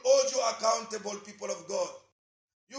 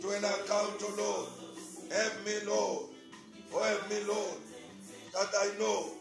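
softer prayer over music, repeating pleas; fervent prayer; 2 semitones lower, 6 dB quieter than usual. Acted. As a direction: pray aloud, leading the church to God.

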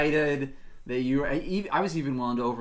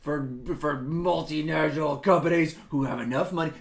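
mock rage; 3 semitones higher, 7 dB louder than usual. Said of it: "you're hulking out".

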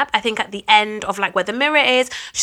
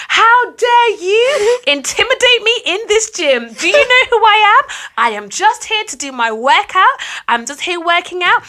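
high-pitched, hammed-up indignant complaint; 6 semitones higher, 4 dB louder than usual. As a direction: play the outraged customer demanding the manager.

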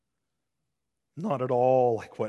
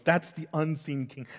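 ordinary talk, nothing marked

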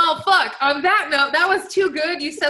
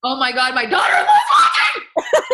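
hammed-up horrified shout, breaking into laughter; mock panic; 6 semitones higher, 6 dB louder than usual. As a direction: build to a high, loud shriek. Imagines father-in-law suddenly seeing her.

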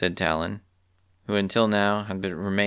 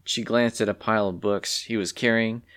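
plain reading voice

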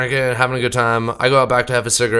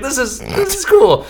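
excited; 14 semitones higher than usual. Retold or imitated. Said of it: "I was like".

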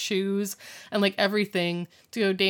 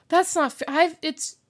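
whiny protest; 6 semitones higher, 4 dB louder than usual. Retold or imitated. Alan complains about taking turns.

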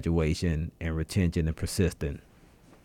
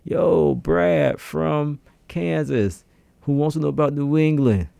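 higher, drawn-out radio shout-out; on-air shout-out; 7 semitones higher, 4 dB louder than usual. Acted. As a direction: hail a far-off listener by name on air. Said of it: "give you a shout out".

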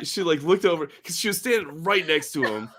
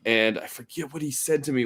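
usual speaking voice

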